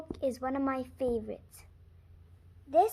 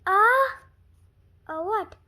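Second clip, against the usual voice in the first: plain reading voice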